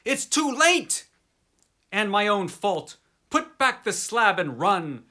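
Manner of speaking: high, loud cry of utter despair; despairing scream. Acted. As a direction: beg him in despair. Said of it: "changed to a scream of utter despair".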